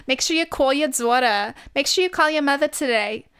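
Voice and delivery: Long Island accent. Shown as a demonstration. Signing off as a Long Island mom, hammed-up accent. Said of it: "what a Long Island mom sounds like".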